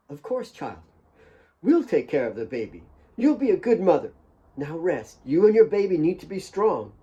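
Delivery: put-on nanny comforting a grieving girl; soothing. Mary soothes Kate.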